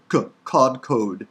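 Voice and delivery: K alone, then KODKOD with hard k's; sounding out. Shown as a demonstration. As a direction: slowly break out the k in kodkod. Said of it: "There's the k".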